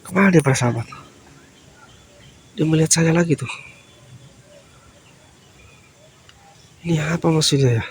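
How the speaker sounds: low, soft aside to the viewers; low whispered vlog narration; hushed commentary. Real, not acted. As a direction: murmur to your audience so he won't hear.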